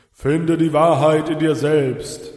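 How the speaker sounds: slow, loud, otherworldly boom. A narrator's reading. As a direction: boom it out like a spirit from the dark. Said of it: "dröhnte die Stimme".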